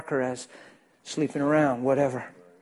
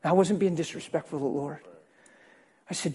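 ordinary talk, nothing marked

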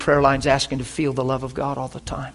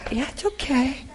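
put-on soothing baby talk; 11 semitones higher than usual. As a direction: hush a baby gently. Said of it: "He's patting his little four-month-old".

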